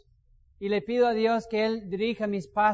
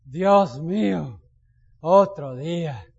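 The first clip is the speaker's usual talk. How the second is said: very slow, louder, despairing moan; dreading the day; 3 semitones lower, 5 dB louder, much slower than usual. Shown as a demonstration. Preacher imitates a churchgoer waking in dread, slow despairing moan. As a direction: drag each word out in misery. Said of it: "Hay gente que dice".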